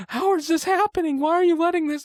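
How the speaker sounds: put-on very high, panicky little-girl voice; hammed-up falsetto protest; mock blaming. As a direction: squeal it like the helpless victim. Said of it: "she's blaming everyone else".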